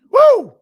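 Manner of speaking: loud high whoop; real celebratory woo; cheering whoop. Real, not acted. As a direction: let out a big cheer. Host cheers at trade rumor.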